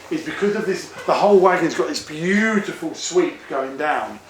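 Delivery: ordinary talk, nothing marked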